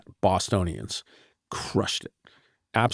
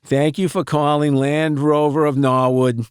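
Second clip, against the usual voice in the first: slow, drawn-out Boston receptionist voice, higher and louder; Boston accent; 5 semitones higher, 5 dB louder than usual. Retold or imitated. Imitating a recorded Boston phone greeting in a thick accent. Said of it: "she would say".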